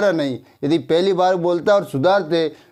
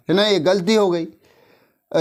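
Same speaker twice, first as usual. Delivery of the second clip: usual speaking voice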